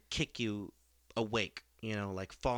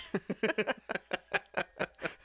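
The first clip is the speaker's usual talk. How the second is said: breathy burst of laughter; high laugh; 8 semitones higher, 3 dB louder than usual. Real, not acted. Laughs at own joke.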